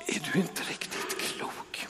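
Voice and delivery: whispered, scandalised aside; incredulous whisper. Retold or imitated. Onlookers thinking Jesus has lost his mind, whispered disbelief. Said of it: "måste de ha tänkt".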